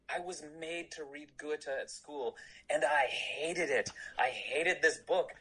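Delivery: lightly voiced German fan, high and slow; imitating German fan. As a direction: quote the towering fan, lifted and drawn out. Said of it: "he was German".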